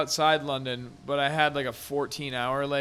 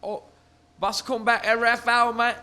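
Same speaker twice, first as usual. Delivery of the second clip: rough attempt at a British bus driver; badly faked British accent, higher than usual; 5 semitones higher than usual. Imitating the bus driver to friends, bad British accent. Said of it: "had a British accent and shit".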